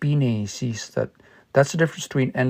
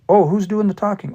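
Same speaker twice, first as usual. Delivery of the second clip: confused listener; 7 semitones higher, faster than usual. Retold or imitated. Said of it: "if I said that".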